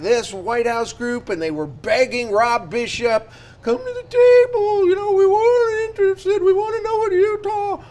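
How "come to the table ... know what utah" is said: high-pitched put-on voice, far above usual; exaggerated high sing-out of a retold plea; mock pleading voice. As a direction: imitate officials begging in a loud, high mocking voice. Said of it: "Is this the voice they use".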